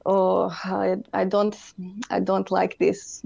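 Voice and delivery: lightly put-on Serbian accent for dad, slow and grumbling. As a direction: imitate a Serbian dad, mildly displeased, in a Serbian accent. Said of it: "I love how that accent just, just instantaneously came out".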